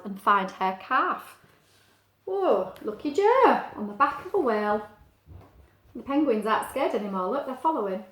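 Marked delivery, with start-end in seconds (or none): 2.2-4.9 excited. Real, not acted. Excited about Joe on whale.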